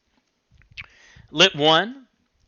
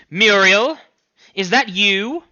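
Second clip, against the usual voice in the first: higher, louder fretful mother voice; worried mother asking if it's her daughter; anxious mother; 6 semitones higher, 5 dB louder, slower than usual. Acted. Mother's voice on the phone.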